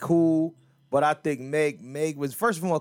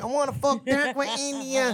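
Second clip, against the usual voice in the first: mocking flat rapper voice; 9 semitones higher than usual. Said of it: "she don't have any inflection".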